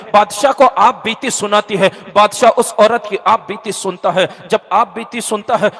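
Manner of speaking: ordinary delivery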